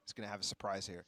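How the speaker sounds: live play-by-play, rising with the action; esports caster. Real, not acted. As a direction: call the round as it unfolds.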